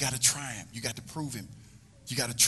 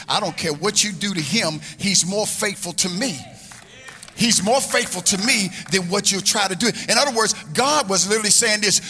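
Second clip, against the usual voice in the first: loud, high preacher's cry of God's words; preacher shouting God's promise about Job; full-force preaching; 5 semitones higher, 9 dB louder than usual. Acted. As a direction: proclaim it over the congregation. Preacher voicing God, full force.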